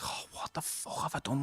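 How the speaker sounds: despairing whisper. Retold or imitated. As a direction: breathe it out in shock. Nan says mum won't talk.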